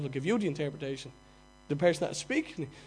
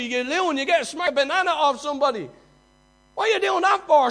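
loud, high scornful jeer; the world screaming at a believer, mocking; 11 semitones higher, 11 dB louder than usual. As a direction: voice the world's sneer at the faithful, much higher. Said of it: "screaming at you".

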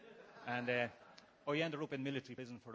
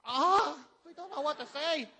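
much higher and louder, flustered panic; panicked outburst; 13 semitones higher, 12 dB louder, slower than usual. Retold or imitated. Panics when asked his number.

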